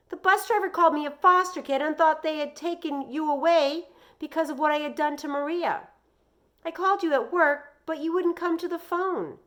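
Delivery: put-on high, loud, rushing upset boy; frantic boy. A narrator's reading. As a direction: spill every worry to mom. Joey pouring out worries.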